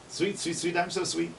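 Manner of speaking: high, light chirping bird voice; sing-song the warbler's call up high; imitating yellow warbler. Shown as a demonstration. Teacher mimics yellow warbler for class, high chirpy. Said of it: "Yellow warbler saying".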